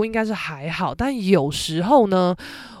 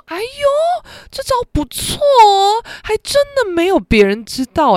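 much higher and louder, syrupy teasing praise, slow on 这招不错哦; mock praise; 14 semitones higher, 7 dB louder than usual. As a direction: coo over her as if praising a child. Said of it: "那我就夸她".

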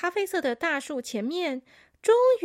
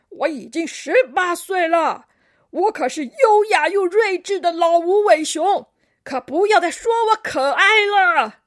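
put-on loud, indignant old-timer; grumpy elderly koala hollering down from the tree; huffy old grandpa voice, loud and emphatic; 5 semitones higher, 11 dB louder than usual. A narrator's reading. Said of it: "传来一个苍老的声音".

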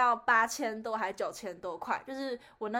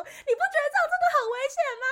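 mocking her friend; 5 dB louder, much faster than usual. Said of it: "然后你指着她说".

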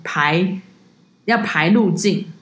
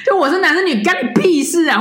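higher and louder, laughing outrage; laughing indignation; 11 semitones higher, 3 dB louder than usual. Real, not acted. Guide telling the rude tourist off, laughing and much higher. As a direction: laugh while snapping back how dare you.

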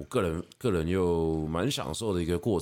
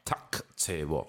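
塔切不 slowly and softly, vowels drawn out; slow 塔切不 right down; 塔切不 stretched, each syllable held; 2 semitones lower, 4 dB quieter than usual. Shown as a demonstration. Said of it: "我把它发得很慢".

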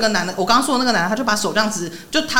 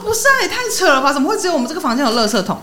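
incredulous; 6 semitones higher, faster than usual. Retold or imitated. Dream argument, exasperated disbelief.

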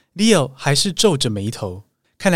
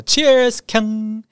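toast cheer; 8 semitones higher than usual. Shown as a demonstration. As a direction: raise a glass and call out cheers. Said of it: "喝酒干杯的时候你才喊".

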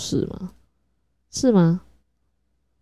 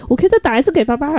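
higher, louder and fast; happy announcement; 9 semitones higher, 4 dB louder, faster than usual. Retold or imitated. Mother telling her daughter she can call dad again, higher, louder and fast. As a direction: rush it out with glee.

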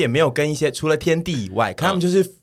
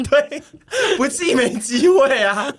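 laughing into sobs; 10 semitones higher, slower than usual. Real, not acted. Laughs about sending gifts.

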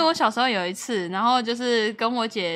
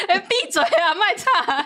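higher, slowed, bossy sister's voice; mock big sister scolding; 6 semitones higher, slower than usual. Sister snapping at squabbling younger siblings, mock bossy scold. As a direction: play the annoyed older sister telling kids off.

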